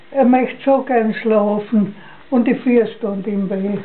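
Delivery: ordinary delivery, nothing marked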